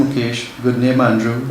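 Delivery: ordinary talk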